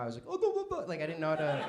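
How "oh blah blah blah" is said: high panicked cartoon babble; squeaky, frantic cartoon-dog gibberish; Courage the Cowardly Dog. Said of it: "a Courage the Cowardly Dog".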